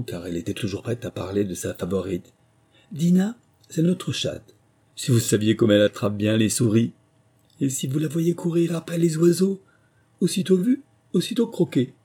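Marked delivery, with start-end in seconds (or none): 2.9-11.9 high-pitched, enthusiastic Alice; eager. A narrator's reading. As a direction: boast keenly about a beloved pet. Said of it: "Alice répondit avec empressement".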